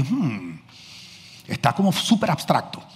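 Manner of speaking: soft, low, doubtful hum; hum, then judge it gently; hesitant critique. Retold or imitated. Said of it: "y dije". (crítica suave y dudosa, en voz baja)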